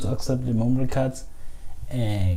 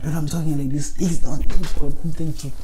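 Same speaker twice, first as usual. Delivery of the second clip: higher and louder, pushed voice; his loud on-mic voice, higher and louder; raised energetic voice; 5 semitones higher, 5 dB louder than usual. Shown as a demonstration. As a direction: project like a hyped-up host. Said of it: "this is not my normal voice".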